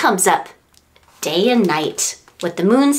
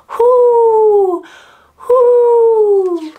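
high, loud hooting owl; 11 semitones higher, 6 dB louder than usual. Pretend. Teacher hooting like the owl for the children, high and loud. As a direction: hoot long like a night owl. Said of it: "the owl says".

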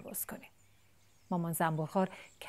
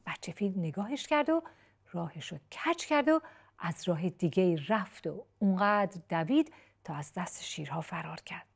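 plain reading voice

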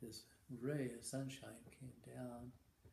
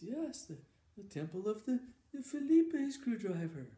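put-on old monk repeating it much higher and louder; insistent old monk; 9 semitones higher, 9 dB louder than usual. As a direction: drill it into him, high and loud. Monk repeats to puzzled Randy.

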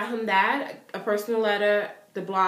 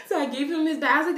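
ordinary talk, nothing marked

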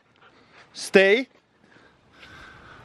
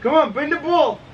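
high, coaxing pet voice; high-pitched dog command; calling the dog; 8 semitones higher than usual. Real, not acted. Urging dog to fetch.

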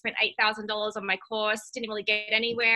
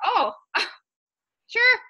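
retold reaction; 11 semitones higher, 5 dB louder than usual. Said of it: "And I was like".

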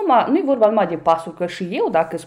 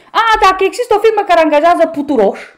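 higher and louder sarcastic jab; mocking exclamation; 9 semitones higher, 8 dB louder, faster than usual. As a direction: throw the pastor's joke back at him, mockingly. Ironizează promisiunea pastorului. (ironie batjocoritoare, mai sus și mai tare)

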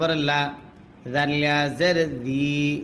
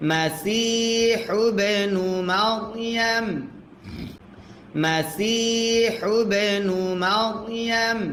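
slow, higher chanted recitation; Quran recitation; 6 semitones higher, much slower than usual. Shown as a demonstration. Teacher chanting the full phrase for the class. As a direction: sing the phrase out slowly.

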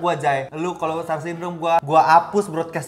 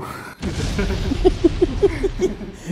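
high, wheezy giggle fit; real high laughing; helpless giggling; 7 semitones higher than usual. Real, not acted. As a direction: crack up at your own story. Laughing at own absurd story.

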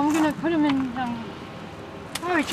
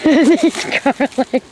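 laughing; 2 semitones higher, 8 dB louder, much slower than usual. Real, not acted. Laughing echo of husband.

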